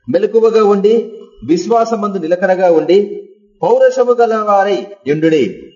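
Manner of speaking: verse read aloud. Acted. Preacher to congregation, reading the verse slowly. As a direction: lay each word of the verse out slowly.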